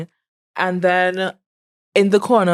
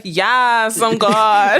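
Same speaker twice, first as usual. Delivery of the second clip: raised, proud mock God voice; fake God; 3 semitones higher, much slower than usual. Ghost posing as God, corrects friend's take with raised mock voice. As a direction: puff up like a pretend God. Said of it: "No, he's like".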